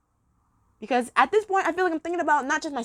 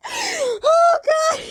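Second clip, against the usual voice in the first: laughing; 6 dB louder than usual. Real, not acted. Laughing fit after the penguin joke.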